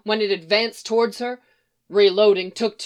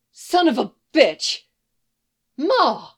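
loud, high shout of alarm; startled yell; 5 semitones higher, 6 dB louder, slower than usual. Acted. Grown son startled in barn, loud high yell. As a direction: yell it out in shock. Clark shocked seeing Martha.

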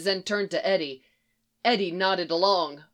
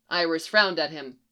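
plain reading voice

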